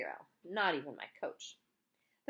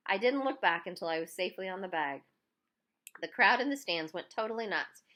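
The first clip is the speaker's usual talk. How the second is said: ordinary delivery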